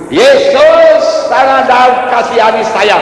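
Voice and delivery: loud, high cry for mercy. Acted. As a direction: call out to someone far off, pitched high. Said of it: "Bartimeus berseru". (berseru nyaring)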